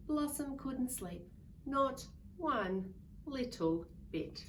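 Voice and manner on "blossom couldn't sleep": usual speaking voice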